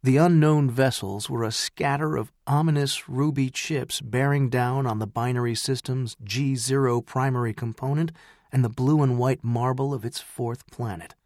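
ordinary delivery

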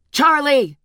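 high, loud screeching call. Acted. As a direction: screech the name across the hall. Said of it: "Cookie screeching".

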